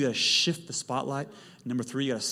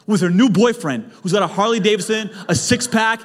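building comic rant; 8 semitones higher, 12 dB louder than usual. Acted. Escalating worst-case rant.

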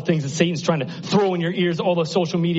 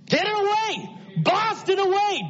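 urgent command. Acted. God's urgent command to clear noise.

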